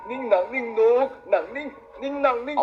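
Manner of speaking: put-on slow, sing-song talk to a baby; cute baby talk. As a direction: coo slowly at a cute baby. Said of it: "Aku ingin dengar suara imutmu".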